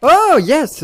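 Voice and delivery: high, loud burst of joy; triumphant cheer. Real, not acted. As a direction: cheer the lucky find. Trouve un coffre. (cri de joie aigu et fort)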